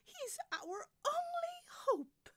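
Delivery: very high, loud diva plea; pleading soprano. Acted. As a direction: plead as if all depends on it.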